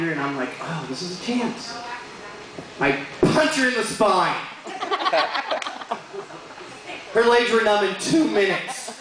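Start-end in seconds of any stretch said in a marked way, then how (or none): 0.6-1.7 sly whisper. Retold or imitated. Inner thought during intimacy. 4.4-6.4 breathy laugh. Real, not acted. Laughs at own punchline.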